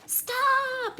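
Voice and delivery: high, loud panicked yell; yelling stop. A narrator's reading. Big Anthony yelling at the overflowing pot, panicked. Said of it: "yelled Big Anthony".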